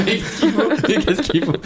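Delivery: laughing through the words; giggling mid-sentence. Real, not acted. Laughing while quoting the film.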